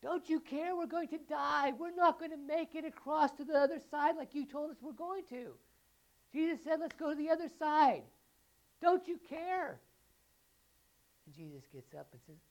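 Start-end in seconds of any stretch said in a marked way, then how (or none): 0.0-9.8 high, loud, desperate pleading; panicked disciples. Acted. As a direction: shout like you're going under. Panicked disciples quoted again.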